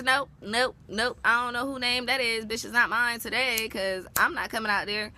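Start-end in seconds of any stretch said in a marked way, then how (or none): none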